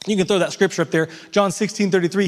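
ordinary talk

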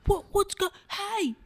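high-pitched shout held down in volume; mimed protest. Retold or imitated. Golfer gesturing that they are stuck, high mimed protest. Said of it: "throwing my hands going".

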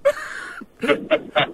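airy wheezing laugh; breathless laugh. Real, not acted. Host cracking up.

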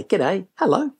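high, breezy hello; cheery greeting. Shown as a demonstration. Speaker shows how to greet customers, cheerily. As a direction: greet them brightly, pitched well up.

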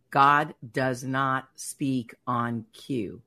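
very slow, each word set apart, GOD leaned on; slow, solemn repeat. Retold or imitated. A wife repeating what God said, slow and solemn. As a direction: spell it out slowly, GOD first and weighty. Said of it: "And then I heard it again".

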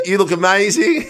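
lightly put-on singer's accent, a little high; gushing compliment in a half-laughing accent impression; [name] accent. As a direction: try the accent, giggling at yourself. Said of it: "Kelly Rowlands doesn't talk like that".

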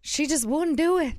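mock Southern lament; Southern accent. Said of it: "we just became Southern".

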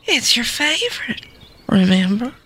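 sweet-talking Southern mother; Southern accent. Acted. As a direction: coax gently in a Southern accent. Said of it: "do this in a Southern accent".

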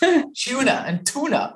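"tuna" said twice, laughing; "tuna" American, then "tuna" Aussie; two ways of saying tuna. Shown as a demonstration. Host contrasting two ways of saying tuna, laughing. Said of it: "that word just cracks me up".

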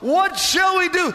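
high, loud anguished cry; desperate cry. Retold or imitated. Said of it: "they cry out".

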